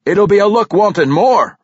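high, loud dwarf boast; boastful. A narrator's reading. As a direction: brag heartily, as a proud father. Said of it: "Reginald boasted".

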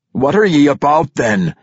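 a king confronting a disgraced dwarf; demanding king. A narrator's reading. King demanding answers from Brunner, stern and loud. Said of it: "the king demanded".

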